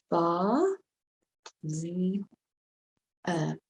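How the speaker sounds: "Ba, zi, er" as separate sounds with long gaps; broken into sounds. Shown as a demonstration. Teacher breaking up father for learners, sounded out slowly. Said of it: "we break up".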